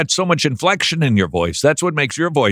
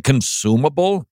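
ordinary delivery

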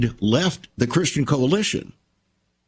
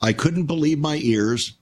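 ordinary talk, nothing marked

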